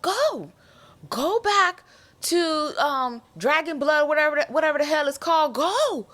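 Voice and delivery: high, loud urging, go go go; cheering Rhaenys on to escape; urgent pleading. Retold or imitated. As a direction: beg her to flee. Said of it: "in my head, I was like".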